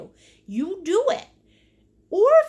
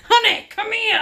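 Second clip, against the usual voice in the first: louder, higher call through laughter; calling out laughing; 6 semitones higher, 6 dB louder than usual. Real, not acted. Wife calling her husband into the room, laughing. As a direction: call across the room while giggling.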